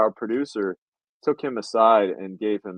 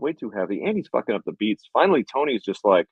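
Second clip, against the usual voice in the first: ordinary talk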